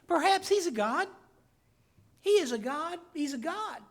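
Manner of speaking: mock-sincere jab at Baal's prophets; mocking Elijah. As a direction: sneer at the false god. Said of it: "he mocks them for their beliefs".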